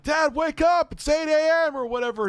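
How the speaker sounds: put-on very high, loud kid voice; shouted mock wake-up call; son waking dad. Son waking dad in the morning, high loud voice. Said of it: "one of the lines he says".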